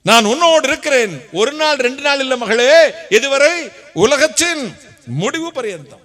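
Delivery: loud, high, fervent preaching; preaching at full force. Acted. Preacher to grieving widow, loud fervent promise. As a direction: proclaim God's comfort with full force. Preacher voicing God's comfort loudly.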